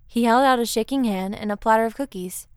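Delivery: ordinary delivery, nothing marked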